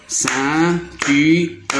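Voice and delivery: CEINTURE split as cein-tu-re with gaps; teacher counting out the syllables of CEINTURE. Shown as a demonstration. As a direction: break CEINTURE into separate beats. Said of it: "en syllabes".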